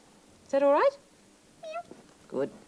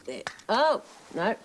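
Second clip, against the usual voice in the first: ordinary talk, nothing marked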